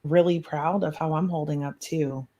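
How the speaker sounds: ordinary delivery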